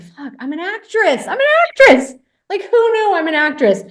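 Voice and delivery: high, loud, building self-congratulation; mock-triumphant elation. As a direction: exclaim it like a sudden big discovery. Retold elation after nailing the scene.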